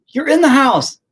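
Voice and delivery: put-on high, loud yell at the kids; exasperated yell. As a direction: shout it, fed up with them. Yelling back at the kids.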